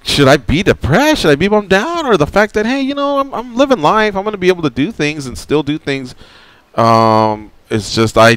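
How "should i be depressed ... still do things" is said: excited inner voice. Retold or imitated. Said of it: "I was like".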